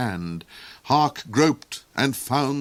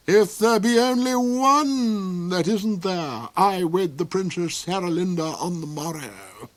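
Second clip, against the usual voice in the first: villain duke voice; 8 semitones higher than usual. A narrator's reading.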